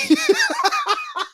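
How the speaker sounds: high squeal of laughter. Real, not acted. Losing it over the parking lot image.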